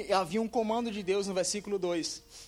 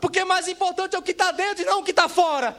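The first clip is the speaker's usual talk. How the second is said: loud, high preacher's delivery; impassioned pulpit proclamation, pitched high; full-force preaching; 9 semitones higher, 7 dB louder than usual. Real, not acted. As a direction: drive each point home loudly to the congregation.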